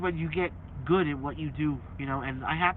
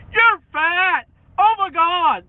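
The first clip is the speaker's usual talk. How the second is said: high loud whiny taunting; mocking trolls; 13 semitones higher, 13 dB louder, much slower than usual. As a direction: chant the insults in a whiny high mock voice. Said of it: "That's my favorite".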